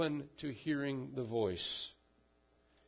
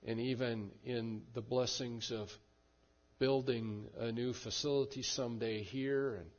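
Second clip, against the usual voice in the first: closing prayer. Acted. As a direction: speak to the Father, reverent and calm. Pastor leads closing prayer.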